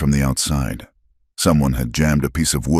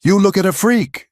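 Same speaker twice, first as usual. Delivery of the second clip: high, louder scream; 3 dB louder than usual. A narrator's reading. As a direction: scream the word freak at him. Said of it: "she screamed".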